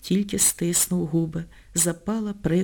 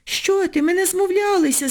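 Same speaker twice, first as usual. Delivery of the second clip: frightened; 10 semitones higher than usual. A narrator's reading. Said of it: "злякалася веснянкувата Стефка".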